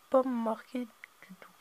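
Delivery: slow whisper to himself; whispered aside. Real, not acted. Host checking the DVD for subtitles, whispering to himself. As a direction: whisper it while squinting at the box.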